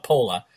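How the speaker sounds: Pola with the final R dropped to "-lah". Retold or imitated. Roommates gave him crap over Pola, said without R. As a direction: say Pola Boston-style, ending on an open vowel. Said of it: "gave me crap about Pola".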